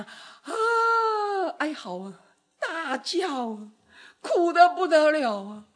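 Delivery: wailing voice breaking, drawn out slowly; mock wailing. Preacher imitating Moses wailing before God, voice breaking. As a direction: let the voice crack as if wailing to God.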